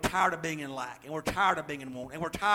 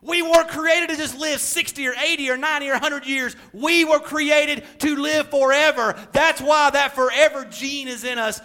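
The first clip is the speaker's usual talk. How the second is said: loud, high, driving sermon delivery; full-force preaching; 7 semitones higher, 8 dB louder than usual. Real, not acted. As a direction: hammer it home to the congregation.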